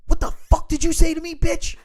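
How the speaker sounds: high, loud mock outburst at the principal; furious dad yelling. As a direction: yell it like a dad who just lost it. Dad explodes at principal, retold.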